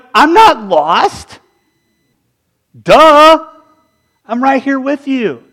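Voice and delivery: a hint of a smug phone, slow and high; talking phone. The lost phone talking back to its owner, lightly voiced and sassy. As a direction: drag out the sass, then snap Duh. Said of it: "If my phone could talk".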